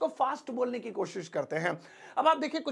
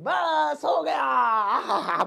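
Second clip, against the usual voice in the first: delighted; 3 semitones higher, 4 dB louder, much slower than usual. Real, not acted. Teacher delighted by answer.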